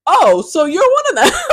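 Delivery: high, loud, sarcastic "oh, so you're one of them"; mock shocked gasp. Retelling to followers, she catches him out, shocked and loud. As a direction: pounce on it like you just caught him out. Catching his hidden homophobia.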